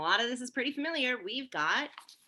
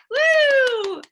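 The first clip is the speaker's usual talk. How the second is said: cheer; 12 semitones higher, 10 dB louder than usual. Real, not acted. Cheering kids after the song.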